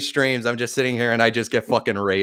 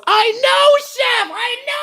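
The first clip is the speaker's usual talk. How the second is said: much higher squeaky shout; squeaky cartoon voice; slower than usual. Streamer answering chat in a squeaky cartoon shout.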